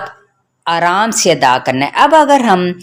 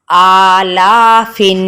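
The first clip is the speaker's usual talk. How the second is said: आलाफिन slowly, आ and ला equally long; equal stretching; much slower than usual. Shown as a demonstration. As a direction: draw out both आ and ला evenly. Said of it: "दोनों को equal खींचना है". (आ और ला दोनों बराबर खींचें)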